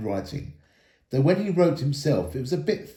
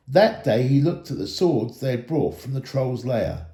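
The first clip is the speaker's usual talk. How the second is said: ordinary delivery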